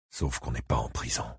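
very low, soft aside; inward thought. A narrator's reading. Ike hearing a reproach in his head, musing softly. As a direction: think it softly to yourself. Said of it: "songea-t-il".

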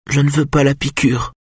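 put-on high, plaintive child's refusal; sad little boy. A narrator's reading. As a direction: plead unhappily in a small child's high voice. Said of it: "dit-il d'une voix triste".

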